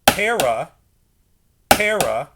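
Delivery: para, first syllable hit; para, called out to the beat; accented para. Shown as a demonstration. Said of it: "we have".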